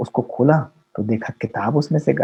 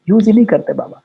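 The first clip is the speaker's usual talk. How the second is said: raised, higher-pitched preaching voice, building passion; fervent preaching; 5 semitones higher than usual. Acted. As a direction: urge the audience with rising fervour. Preaching with rising fervour.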